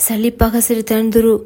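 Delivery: usual speaking voice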